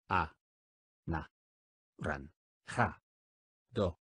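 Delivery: "A. Na. Ran. Ja. Do." each syllable spoken apart with a pause. Shown as a demonstration. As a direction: split "Anaranjado" into separate syllables. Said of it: "dila silábicamente conmigo". (separando sílabas: "A. Na. Ran. Ja. Do.")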